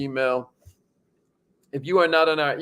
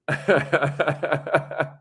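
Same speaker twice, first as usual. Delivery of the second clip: higher, rolling chuckle; long laugh; 4 semitones higher than usual. Real, not acted. Host joking about sharing Kobe Bryant's birthday, laughing. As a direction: laugh in a long run of quick ha-has. Amused at own birthday boast.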